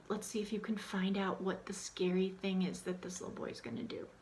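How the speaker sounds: secret-telling read-aloud voice; hushed storyteller. Shown as a demonstration. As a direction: build mystery in a whisper. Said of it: "set the tone".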